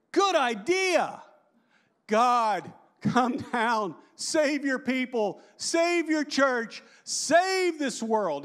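high, slow, drawn-out plea; pleading Moses. Pretend. Moses imagined begging God to save the world, high and slow. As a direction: beg heaven slowly and high.

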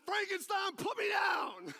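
very high, loud mock plea; pained shout. Pastor hoisted in a crushing hug, shouting to be let go. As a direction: cry out as if being crushed. Squeezed in pain, begs release.

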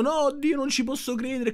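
high-pitched mimicked "oh my God" outburst; mock cry of disbelief. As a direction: imitate an overwhelmed woman crying out. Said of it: "urla dicendo".

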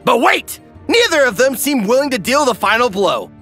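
ordinary delivery

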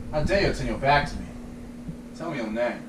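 quiet, steady mock emperor, no laughter; straight-faced quote. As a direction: hold it together, low and hushed. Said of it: "My name is Maximus Decimus".

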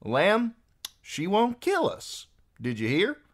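mocking amusement. A narrator's reading. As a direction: crow it to your mate in mock wonder. Archer laughs at Arya's threat.

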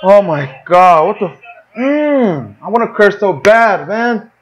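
high, slow, incredulous groan at the game; exasperated outburst. Real, not acted. Gamer to viewers as defence fails, high disbelieving wail. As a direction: stretch out the disbelief, almost crying. Goal conceded in FIFA match.

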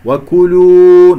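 loud, long-held وكلوا with full damma; rounded lips. Shown as a demonstration. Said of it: "نضم الشفتين". (ضم الشفتين في وكلوا)

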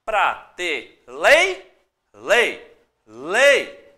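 "Pratelei, lei, lei" stretched slowly, syllable by syllable; syllables drawn out. Shown as a demonstration. Teacher sounding out the word for students, slowly stressed. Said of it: "ele trouxe pra nós prateleiras".

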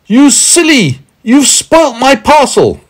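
high, loud, drawn-out scolding yell; shouting. A narrator's reading. Man shouting across the stream at Meddle, shouting. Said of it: "shouted once more to him".

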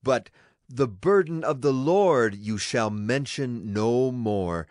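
ordinary delivery

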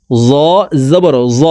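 জ said thick and full; heavy letter. Shown as a demonstration. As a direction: make জ heavy, unlike thin জাল. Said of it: "জ মোটা করে উচ্চারণ হবে".

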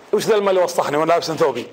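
low, fast, annoyed grumble; Omar's irritated outburst. Retold or imitated. Preacher voicing Omar, annoyed at his dirty robe, fast and low. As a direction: rattle it off, low and put out. Omar angry at soiled clothes.

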